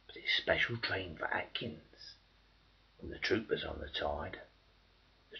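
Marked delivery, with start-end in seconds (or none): 0.1-2.1 hushed whisper, dropping right down; soft bitter whisper; ironic whisper. Acted. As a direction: whisper the irony. Ironic turn in refrain.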